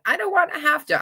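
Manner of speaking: high whining mock refusal; whiny refusal. Mimicking her reluctant self in a high whine.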